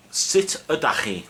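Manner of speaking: Sut ydach chi said slowly, sit uh-dach ee, stress on ydach; teacher modelling Sut ydach chi; Welsh pronunciation. Shown as a demonstration. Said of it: "That's formal".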